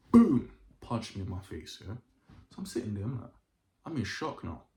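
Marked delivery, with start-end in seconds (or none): 0.1-0.5 loud punch sound effect, shouted. Retold or imitated. Retelling the older's punch, loud boom. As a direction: bang it out like the fist landing.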